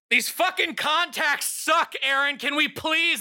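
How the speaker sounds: high, whining complaint; whiny NPC voice. Acted. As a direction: whine like a fed-up trainee.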